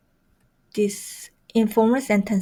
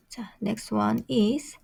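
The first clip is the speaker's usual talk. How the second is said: whispered aside; 7 dB quieter than usual. Real, not acted. Teacher moving to next word.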